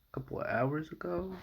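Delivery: ordinary talk, nothing marked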